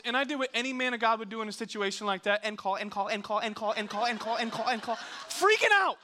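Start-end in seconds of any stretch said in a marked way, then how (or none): 2.4-5.0 evenly chanted, no gaps; like a stuck record, building slightly; relentless repetition. Acted. Mimics endless repeated calling. 5.3-6.0 high, loud burst of panic; sudden freak-out shout; panicked yell. Retold or imitated. Acts out his own panic.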